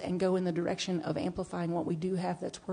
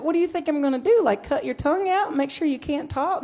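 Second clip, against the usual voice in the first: mock alarm; 8 semitones higher, 5 dB louder than usual. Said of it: "I'm like".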